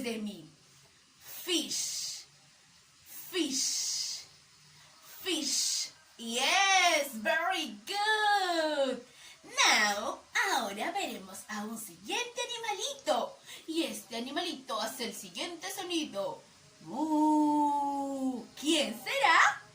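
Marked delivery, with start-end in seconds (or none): 1.2-5.9 Fish said slowly and clearly, ending in a long sh; model pronunciation. Shown as a demonstration. Teacher models Fish for the child to repeat. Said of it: "Repeat after me". 16.8-18.4 cow moo. Pretend. Animal sound for guessing game.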